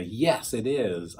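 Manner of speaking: normal talking voice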